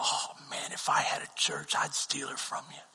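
breathy whisper, teasing; whispered joke. Retold or imitated. Mentor whispering he'd steal the worship leader. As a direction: whisper it like a secret tease. Mentor's joking confided aside.

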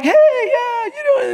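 high, cheerful greeting; friendly greeting. Retold or imitated. Colorado locals finally greeting the preacher back, high cheerful. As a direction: wave back, caught up in the friendliness.

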